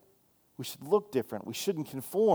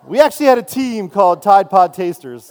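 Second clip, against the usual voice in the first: laughing; 5 semitones higher, 12 dB louder than usual. Real, not acted. Laughing at his own joke.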